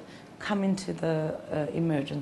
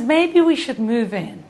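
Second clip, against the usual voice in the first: higher, louder, through laughter; laughing; 4 semitones higher, 8 dB louder than usual. Retold or imitated. Husband suggesting to Martha they move in, laughing. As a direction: chuckle the suggestion.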